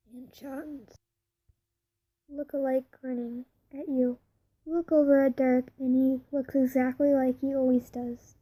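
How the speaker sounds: ordinary delivery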